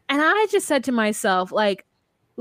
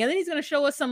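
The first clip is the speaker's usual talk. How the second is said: ordinary delivery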